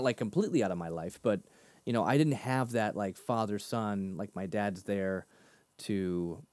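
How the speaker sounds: usual speaking voice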